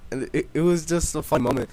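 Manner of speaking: ordinary delivery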